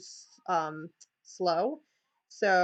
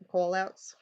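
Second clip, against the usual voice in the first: New York accent. Shown as a demonstration. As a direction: call outs repeated in a New York accent. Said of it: "excuse my New York accent".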